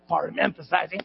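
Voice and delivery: ordinary delivery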